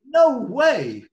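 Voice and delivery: high, loud disbelieving yelp; surprised. Real, not acted. Host hearing the book title, loud surprised cry. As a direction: blurt it out in delighted disbelief.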